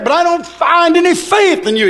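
a hint of a disappointed God's reproach; God rebuking Israel. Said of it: "he said".

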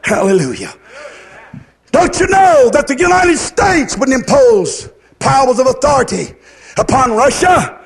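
ordinary talk, nothing marked

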